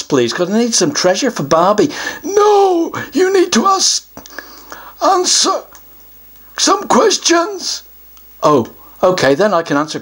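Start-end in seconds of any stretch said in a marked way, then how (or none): 2.2-7.8 higher troll voice, a sudden hushed word; a bossy little troll laying down rules. Acted. Troll tells the child to answer questions, bossy.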